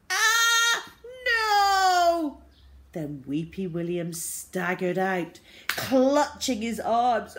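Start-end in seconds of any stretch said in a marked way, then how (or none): 0.1-2.4 high, loud wailing scream; terrible screaming. A narrator's reading. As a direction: scream in protest, drawing it out. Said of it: "came the terrible screams".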